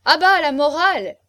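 high, very loud jeer; heckling shout. A narrator's reading. Guests cut off the marquis's moralising, heckling. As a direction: shout it down at full volume.

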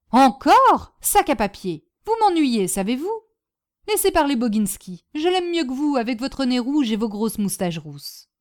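outburst on the first word, then testy scolding; exasperated Madame Bombec. Acted. Hostess telling off a guest who bores her, exasperated. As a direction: cut off the bore crossly.

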